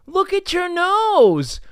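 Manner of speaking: high, loud, slow obvious tone; mock matter-of-fact. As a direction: say it like it's totally obvious. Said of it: "she said it like".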